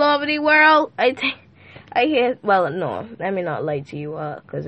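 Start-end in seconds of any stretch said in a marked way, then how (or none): none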